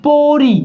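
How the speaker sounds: "পরি" with both vowels held long; deliberate, sustained "পরি"; pronunciation demo. Shown as a demonstration. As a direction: draw out "পরি" so the final i is plain. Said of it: "ই উচ্চারণ হচ্ছে না".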